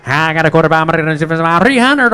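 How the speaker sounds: loud, fast auctioneer chant; hammed-up auction call to the crowd; mock auctioneer patter. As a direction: rattle off bids like an auctioneer.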